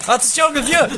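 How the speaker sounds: crié très fort et aigu; cri d'alerte. Acted. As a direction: hurle l'avertissement d'un coup. Avertit d'un piéton renversé. (moniteur qui crie pour prévenir du vieux)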